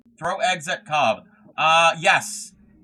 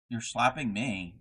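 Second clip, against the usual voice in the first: ordinary delivery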